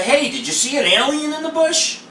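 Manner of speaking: high-pitched, hammed-up "hey" question; mock casual question. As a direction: pretend to ask something absurd in a high voice. Imagined absurd question to shaken investigator.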